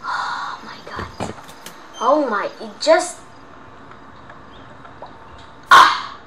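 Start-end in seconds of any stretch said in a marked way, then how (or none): 0.0-1.3 slow breathless hush; whisper. Real, not acted. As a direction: whisper it so nobody hears. Whispered while sneaking.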